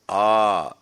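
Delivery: loud, open "ah"; open "ah" demo. Shown as a demonstration. Teacher demonstrating the basic human sound, loud "ah". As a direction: let a strong "ah" out of an open mouth. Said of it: "the basic sound of a human voice".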